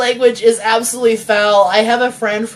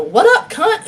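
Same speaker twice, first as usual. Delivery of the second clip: old lady impression; 10 semitones higher, 3 dB louder than usual. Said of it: "an eighty-five-year-old woman".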